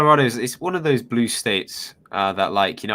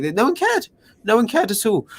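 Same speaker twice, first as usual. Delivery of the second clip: ordinary delivery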